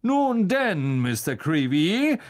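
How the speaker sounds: high, loud, swooping address; self-important showman; jovial pompous celebrity. Acted. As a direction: greet him grandly.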